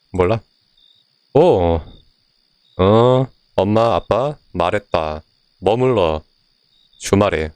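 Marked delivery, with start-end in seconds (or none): none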